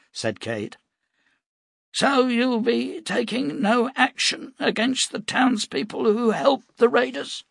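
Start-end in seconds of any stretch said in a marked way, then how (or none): 1.9-7.4 put-on high, pointed old man's challenge; old prophet, accusing. Cade challenging the captain, accusing old prophet. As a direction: pin him with the question.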